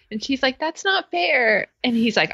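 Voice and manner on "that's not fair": high, drawn-out mock protest. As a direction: imitate someone objecting, pitch way up. Retold protest in roommate quarrel.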